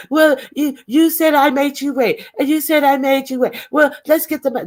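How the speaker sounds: high-pitched mimicked bickering; mock complaining. Woman mimicked arguing over cell phone proof, mock complaining. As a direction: mimic her petty protest up high.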